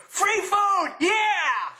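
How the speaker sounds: high, loud whoop of delight; gleeful cheer. Acted. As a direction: shout it out like a greedy celebration. Mock cheer after solemn funeral email.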